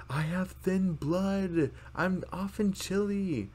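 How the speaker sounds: high, drawn-out put-on voice; over-exaggerated excuse. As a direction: stretch out every word in a hammed-up excuse. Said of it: "like, over-exaggerating".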